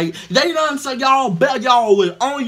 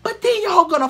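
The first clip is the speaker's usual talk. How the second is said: ordinary talk, nothing marked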